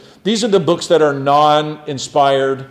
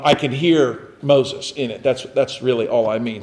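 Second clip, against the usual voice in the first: ordinary talk, nothing marked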